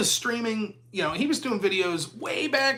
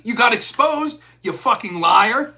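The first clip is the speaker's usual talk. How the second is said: ordinary talk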